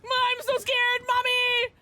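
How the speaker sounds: mock terrified. Streamer mock-panicking at the killer, hammed-up scared cries. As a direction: wail for mommy like a scared kid, playing it up.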